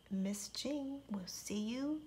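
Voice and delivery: soft whispered goodbye; soft bedtime whisper; goodnight whisper. Real, not acted. Goodnight to children.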